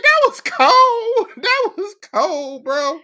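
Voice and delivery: hammed-up awe at a brutal hit; high, stretched, laughing disbelief; mock stunned. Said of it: "I was like".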